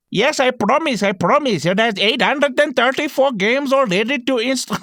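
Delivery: mock Indian accent of a pushy shop owner; lightly voiced Indian shopkeeper swearing he promises. Pawn shop owner pushing a fake console, mock Indian accent. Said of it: "why he has an Indian accent".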